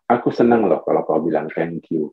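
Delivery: low, gentle statement; assertive wife. Acted. Wife telling husband what pleases her, low, calm assertiveness. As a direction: voice a wife's request low and calm. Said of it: "itu namanya asertif".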